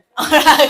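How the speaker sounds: short loud laugh. Real, not acted. Speaker laughing at her story, loud. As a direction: burst out laughing.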